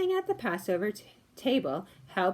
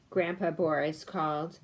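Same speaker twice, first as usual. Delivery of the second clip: ordinary delivery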